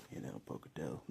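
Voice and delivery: whispered aside to Pocatello; a confiding whisper to the town. Real, not acted. Vlogger whispering to Pocatello voters. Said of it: "whispered aside".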